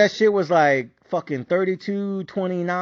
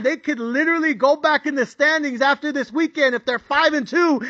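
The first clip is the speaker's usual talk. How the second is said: frantic shouting; 12 semitones higher than usual. Retold or imitated. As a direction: hype yourself up until you're yelling. Frantic self-reassurance building to shouts.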